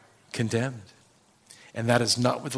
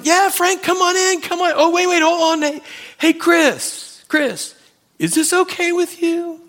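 much higher and louder, gushing welcome; a jolly gatekeeper greeting an old friend; hammed-up Peter; 5 dB louder than usual. Saint Peter greeting Frank at the gate, gushing and high.

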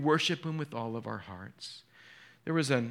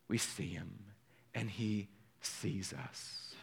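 softer, slow and welling up with emotion; choked up; 6 semitones lower, 8 dB quieter, slower than usual. Real, not acted. Pastor moved by meeting Jesus's gaze, softly choked up. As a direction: hold back real tears and let it catch. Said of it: "I'm getting all choked up here".

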